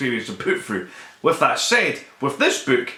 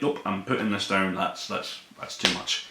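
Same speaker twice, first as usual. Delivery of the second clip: softer and lower, fading to breath; hushed, shaken retreat; 5 semitones lower, 7 dB quieter than usual. Retold or imitated. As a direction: back away from it quietly, overwhelmed. Quoting self putting book down.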